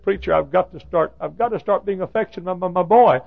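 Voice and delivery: high, loud, pleading voice; a troubled father pleading with his preacher; pleading man. Retold or imitated. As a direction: beg the preacher in earnest. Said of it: "A man ... said".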